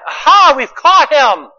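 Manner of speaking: high, loud, slow gloating "Aha"; gloating Pharisee. Retold or imitated. Pharisees gloating at trapping Jesus, loud and slow. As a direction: crow over the trap like a smug accuser.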